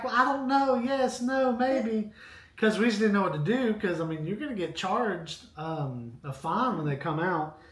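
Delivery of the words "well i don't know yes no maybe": mildly hammed-up indecision, pitched high; high-pitched, unsure retold reply; dithering. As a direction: waver between answers in a higher voice. Retold dithering on fire call.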